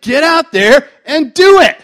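high, slow, very loud command; full-voice preacher's exhortation; loud urging. Real, not acted. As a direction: punch out each word as a charge. Urging the hearers to act.

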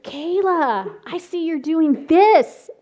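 put-on teacher gently calling out a child's act; knowing teacher. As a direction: let her know you see the game. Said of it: "I said".

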